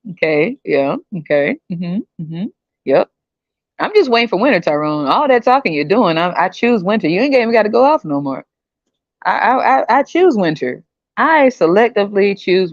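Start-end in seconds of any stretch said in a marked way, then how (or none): none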